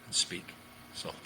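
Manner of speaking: slowing down with gaps, fading softly. Shown as a demonstration. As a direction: ease off, space the words, end very soft. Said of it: "slow it down".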